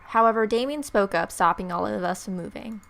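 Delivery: ordinary talk, nothing marked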